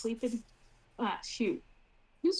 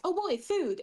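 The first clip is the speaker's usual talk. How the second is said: excited about food; 8 semitones higher than usual. Retold or imitated. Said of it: "she is like".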